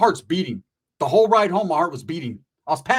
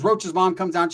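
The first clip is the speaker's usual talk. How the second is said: normal talking voice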